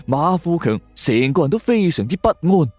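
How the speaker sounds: usual speaking voice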